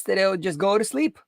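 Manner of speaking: ordinary delivery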